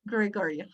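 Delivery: "Gregorio" with soft schwa vowels; a soft "Gregorio" with a relaxed tongue; relaxed, easy "Gregorio"; schwa demo. Shown as a demonstration. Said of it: "the magic of the schwa".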